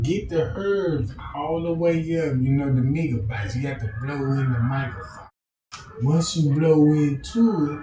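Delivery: ordinary talk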